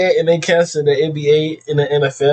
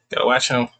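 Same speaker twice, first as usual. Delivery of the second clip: ordinary delivery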